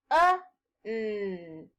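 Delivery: each sound of sun said slowly and separately; sounds said one by one. Shown as a demonstration. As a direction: split "S, uh, n" into single sounds. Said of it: "I'm going to say the sounds".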